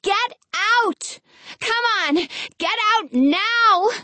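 high, urgent calling through cupped hands; calling up to window. Acted. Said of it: "wrapping her hands around her mouth as a megaphone".

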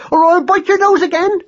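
loud, very high furious shriek; frantic screaming mother; screeched threat. A narrator's reading. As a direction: screech a threat at your son. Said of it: "she screeched".

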